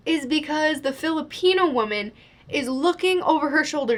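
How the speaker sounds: normal talking voice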